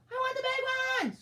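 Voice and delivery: very high, loud, greedy scream; screaming hare. A narrator's reading. Hare grabbing for the biggest present, screaming. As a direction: scream to claim it first. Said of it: "screamed the hare".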